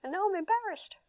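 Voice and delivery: put-on high, small child's voice, lost and embarrassed; a child admitting he's embarrassed; kid voice. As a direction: sound like a kid who can't explain it. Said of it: "They're telling the truth".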